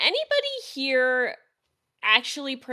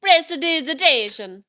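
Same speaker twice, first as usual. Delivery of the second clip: loud, higher mock Italian accent; 5 semitones higher, 7 dB louder than usual. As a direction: belt it out in a put-on Italian accent. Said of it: "Now do a Italian accent".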